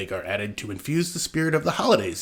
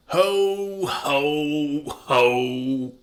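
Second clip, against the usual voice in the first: jolly ho ho ho; 3 semitones higher, much slower than usual. Pretend. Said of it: "You have to actually do ho ho ho, like you're Santa".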